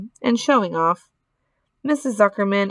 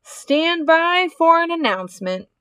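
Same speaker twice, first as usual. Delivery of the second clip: high, ringing public announcement; pompous fairground announcer; booming loudspeaker announcer; 8 semitones higher than usual. Acted. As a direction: boom it out pompously to the crowd. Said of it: "boomed the voice of the loudspeaker".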